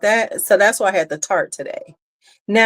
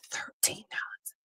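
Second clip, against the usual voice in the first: breathy whispered aside about the price; hushed, scandalised whisper; 19 dB quieter than usual. Real, not acted. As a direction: drop to a whisper as if it's a secret.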